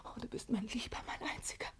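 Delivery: soft, breathy lovers' whisper; tender whisper. Acted. Pauline whispering tenderly to her lover. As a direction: whisper it close to his ear.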